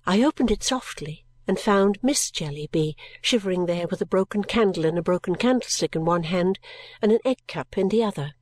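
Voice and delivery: ordinary delivery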